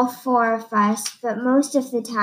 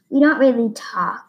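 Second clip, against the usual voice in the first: ordinary delivery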